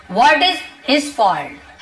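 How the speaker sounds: each word said slowly and clearly for learners; slow model sentence. Shown as a demonstration. Teacher slowly models the sentence for Hindi learners. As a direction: spell it out slowly so students can copy. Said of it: "teacher models the sentence slowly".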